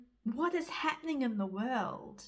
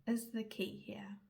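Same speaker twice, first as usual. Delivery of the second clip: whisper; 3 semitones lower, 7 dB quieter than usual. Acted. Whispering the closing thought to her listener. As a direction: trail off into a soft whisper.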